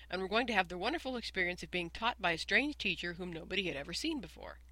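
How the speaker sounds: usual speaking voice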